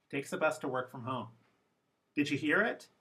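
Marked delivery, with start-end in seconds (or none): 0.1-1.3 fast, "a" and "to" reduced to schwa; defocused English. Shown as a demonstration. Teacher repeating the defocused version for learners. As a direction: run "He takes a bus to work from home." together, weak words as schwa.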